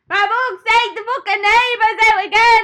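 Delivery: high, loud mock shout in a put-on accent; shouting back. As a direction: holler a hammed-up complaint to a friend down the street. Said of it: "I shout back at her".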